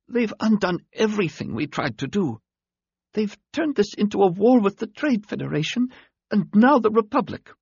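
high, thin alien voice, fading strength; a wounded alien forcing words out; dying alien voice. A narrator's reading.

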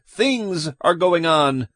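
high, drawn-out protest; agitated. Acted. Man insisting to police that something is wrong, agitated. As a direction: cry it out, pitched high and urgent.

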